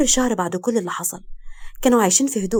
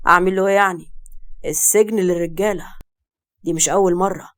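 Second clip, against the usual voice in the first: dismissive father; 2 semitones lower than usual. A narrator's reading. Father dismissing his son's arrest, indifferent.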